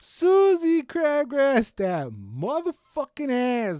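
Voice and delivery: slow, high, drawn-out shout; hyped holler. Real, not acted. Host cheering the Selena actress's nickname, hyped holler. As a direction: belt it out long and loud.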